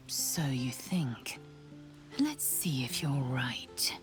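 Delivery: slow, whispery come-on; slow seduction. Acted. Seducer telling a partner I lead, you follow, slow and whispery. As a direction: draw each phrase out, half-whispered.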